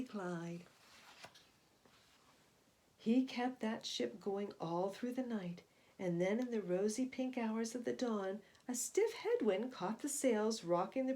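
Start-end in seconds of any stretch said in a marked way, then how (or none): none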